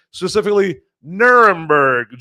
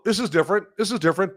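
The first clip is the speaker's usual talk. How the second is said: higher, softer acted reaction; quietly taken aback by a first taste; mock puzzlement; 5 semitones higher, 5 dB quieter, faster than usual. Acting the puzzled first sip.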